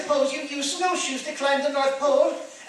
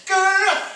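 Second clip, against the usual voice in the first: growl; 4 semitones higher, 5 dB louder than usual. Acted. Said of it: "extra specially ferocious".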